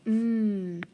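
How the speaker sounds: "mm" as a long held hum; sounding out. Shown as a demonstration. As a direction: hold the bare m sound. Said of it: "how would I spell my".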